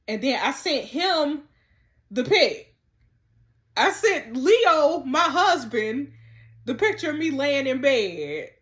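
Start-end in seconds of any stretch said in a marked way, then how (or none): 3.8-6.1 slow, loud, every word stressed; scandalised emphasis. Real, not acted. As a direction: spell it out in disbelief. Sent the picture to husband.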